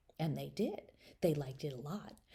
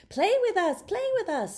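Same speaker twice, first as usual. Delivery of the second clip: put-on high, louder eager doll kids; doll children; 14 semitones higher, 8 dB louder than usual. Doll kids pleading with Charlotta to play, high eager voices. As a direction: beg her to play, high and bouncy. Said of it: "said the doll girl and the doll boy".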